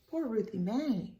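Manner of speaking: ordinary delivery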